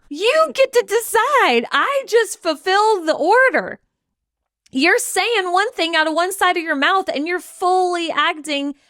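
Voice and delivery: a fed-up God setting the lady straight; sassy God. Retold or imitated. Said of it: "God's like".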